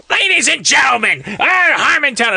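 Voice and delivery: high, strained stage shout; Gilbert Gottfried impression. Comic introducing the show to a crowd gone crazy, shouted high. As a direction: belt out a screechy comic's introduction. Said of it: "What's he gonna sound like when he goes out there".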